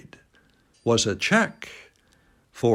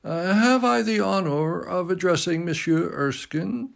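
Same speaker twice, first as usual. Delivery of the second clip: put-on old gentleman with a light French accent; polite bow in a faint French accent; a courteous French-accented greeting, lightly done; slight French accent; 7 semitones higher than usual. A narrator's reading. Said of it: "said in a slight French accent".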